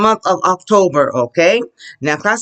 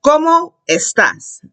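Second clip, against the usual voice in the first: "¿Cómo" high and rising, slowly, then "estás" falling; voice goes up; 7 semitones higher, slower than usual. Shown as a demonstration. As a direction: lift "¿Cómo" for the learners, unhurried. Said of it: "your voice goes up".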